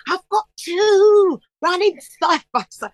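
high, thrilled squeal breaking into laughter; excited boasting. Retold or imitated. Singer telling her online followers her news, excited high squeal. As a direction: squeal it, stretching the words as it builds. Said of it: "'cause I'm like".

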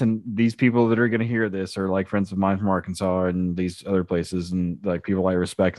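usual speaking voice

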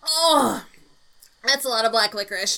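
strained, still feeling the burn; after a shot of liquor. Real, not acted. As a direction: talk through the liquor burn. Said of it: "My whole chest is on fire".

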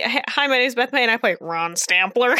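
normal talking voice

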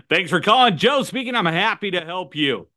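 high-pitched, exuberant phone greeting; over-the-top radio DJ. Shown as a demonstration. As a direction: answer like a hyped-up radio DJ. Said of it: "I'm over the top, radio D J".

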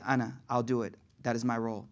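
low, soft, humble answer; quiet acceptance. Acted. Muhammad accepting the role of intercessor, quiet and low. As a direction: take it on softly, pitch dropped.

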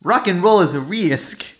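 attempted British accent, high and loud; fail at a British accent on purpose; a weak stab at a British accent; bad British accent. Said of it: "I can't do the accent".

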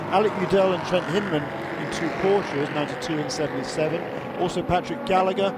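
normal talking voice

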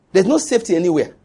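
"There's no safety anywhere" said quickly; brisk statement. Real, not acted. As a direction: state it briskly in one run. First take, brisk statement.

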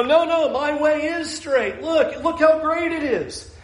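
mock-proud protest; a light, smug insistence; smug self-defence. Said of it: "And we go".

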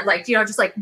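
ordinary talk

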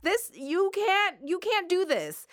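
high, slow outraged protest; exasperated. Retold or imitated. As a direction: plead in high disbelief. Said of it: "And I'm like".

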